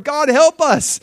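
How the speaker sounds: high, loud mock cry for help; mock desperate plea. Israel turning to the Lord, mock desperation. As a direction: beg in hammed-up desperation.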